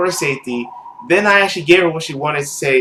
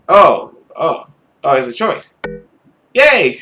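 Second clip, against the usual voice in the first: a burst of delight at the choice; excited; 5 dB louder than usual. Real, not acted. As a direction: cheer it out loud. A new choice appears.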